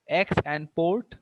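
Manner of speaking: slow, separated "ex" and "port"; syllable by syllable. Shown as a demonstration. Teacher counting syllables for the class, split slowly. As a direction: break it into "ex" and "port". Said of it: "how many syllables are there".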